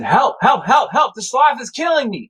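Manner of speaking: high-pitched panicked cries for help; mock scream, pleading to be rescued; retold screaming. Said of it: "I started screaming".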